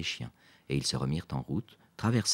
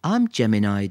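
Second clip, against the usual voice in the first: proud, louder and higher; 6 semitones higher, 10 dB louder than usual. A narrator's reading. Quentin tells Lisa his star sign proudly. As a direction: announce it with pride. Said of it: "dit-il fièrement".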